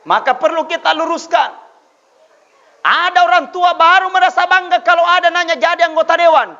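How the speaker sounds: loud preacher's call; fiery preaching. Acted. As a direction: hammer the point home to the crowd. Preaching at full force.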